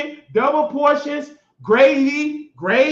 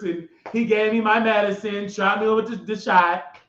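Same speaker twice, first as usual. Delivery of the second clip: usual speaking voice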